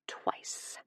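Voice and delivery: breathy whispered tease; a sly whisper. Acted. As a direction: whisper it like a naughty secret. Whispered seductive punchline.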